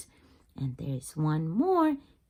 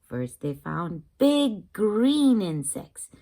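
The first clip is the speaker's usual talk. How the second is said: plain reading voice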